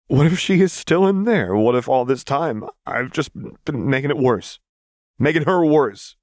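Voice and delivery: high, loud, anguished questions; desperate, shaking voice. A narrator's reading. Vi fearing she was wrong about her sister, desperate. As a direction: plead desperately, voice starting to shake. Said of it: "her voice started to shake".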